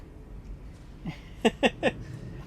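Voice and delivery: breathy giggle at own flop; laughing. Real, not acted. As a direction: laugh it off, high and airless. Laughing at own accent attempt.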